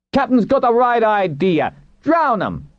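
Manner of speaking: high-pitched mob heckle; angry crowd shout. A narrator's reading. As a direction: egg the crowd on, voice way up. Angry crowd member calls out.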